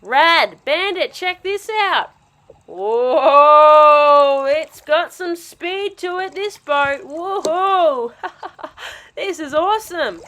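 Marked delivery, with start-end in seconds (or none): 2.7-4.5 surprised whoa. Acted. Boat speeds off.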